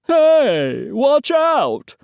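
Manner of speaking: high-pitched startled protest; loud complaint. Acted. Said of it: "complained the other reporter".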